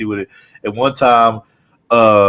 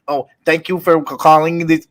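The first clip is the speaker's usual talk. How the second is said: lightly mimicked Middle Eastern accent; higher, a hint of Middle Eastern accent; 5 semitones higher than usual. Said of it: "Middle Eastern accent".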